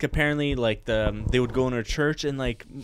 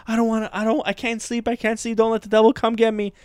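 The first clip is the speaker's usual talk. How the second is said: put-on scared kid; 8 semitones higher than usual. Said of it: "I'd be like".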